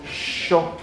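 SHO with a long, soft hush; soft, extended sh. Shown as a demonstration. Teacher introducing circumflex S, soft drawn-out sho. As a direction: take time over the sh. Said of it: "es suave, delicada".

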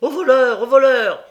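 very loud high cry of thief; a crowd roaring stop thief; full-force shout. A narrator's reading. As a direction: bellow it like the whole crowd joining in. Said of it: "donnant une nouvelle force à ce cri".

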